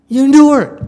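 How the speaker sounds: loud, firm, insistent; a stern teacher barking an order; forceful command. Real, not acted. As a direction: drive the order home hard. Teacher hammering a hard lesson.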